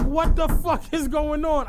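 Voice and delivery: loud, high retold outburst; snapping. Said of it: "I start snapping".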